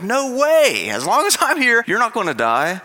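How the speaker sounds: loud high protest; Peter's protest. Retold or imitated. Peter protesting to Jesus, loud and high. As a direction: blurt it out in disbelief. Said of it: "And Peter's like".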